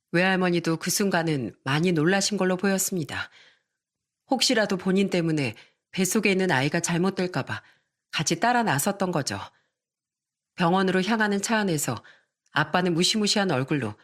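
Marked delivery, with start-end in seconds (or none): none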